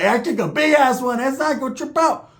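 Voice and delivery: proud homeboy. Retold or imitated. Homeboy excuses the smell.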